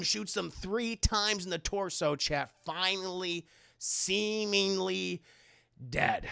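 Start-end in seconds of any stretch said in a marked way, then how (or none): none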